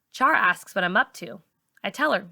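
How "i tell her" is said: ordinary delivery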